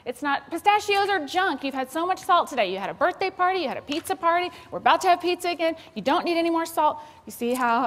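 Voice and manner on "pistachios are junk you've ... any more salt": much higher, loud nagging lecture; high, loud mom nagging about salt. Shown as a demonstration. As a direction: scold like an exasperated mom. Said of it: "I'm just nagging".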